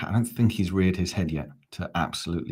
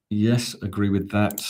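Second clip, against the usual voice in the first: usual speaking voice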